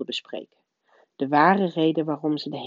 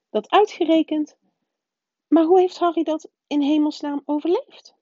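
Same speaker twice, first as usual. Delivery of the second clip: much higher, louder prim witch in disbelief; the strict witch baffled by the news; incredulous professor; 12 semitones higher, 4 dB louder than usual. A narrator's reading. McGonagall voiced by the narrator.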